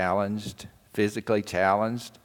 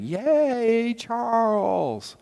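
high, airy mock cheer of a class; kids cheering; 12 semitones higher, much slower than usual. Classmates welcoming Charles back, high airy cheer. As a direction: cheer him on like happy children. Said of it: "and saying".

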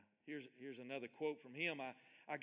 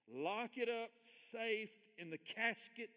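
slow reading; 7 semitones higher, slower than usual. Shown as a demonstration. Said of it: "so I'm gonna read it slow".

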